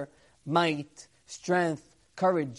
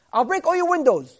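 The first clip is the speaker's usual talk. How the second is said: threat; 8 semitones higher, 8 dB louder than usual. Acted. Villain threatens the rabbi.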